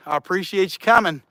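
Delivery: usual speaking voice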